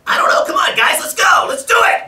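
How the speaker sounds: very high, loud sailor on helium; squeaky helium-pitched cartoon yell; Popeye. Shown as a demonstration. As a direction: shout a rallying cry in a helium cartoon voice. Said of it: "Popeye on helium".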